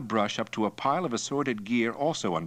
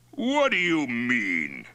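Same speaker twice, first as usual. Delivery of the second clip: slow, higher, affronted question; glaring indignation; 5 semitones higher, 4 dB louder, slower than usual. A narrator's reading. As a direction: challenge him, stretching each word. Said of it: "Toktai glared at him".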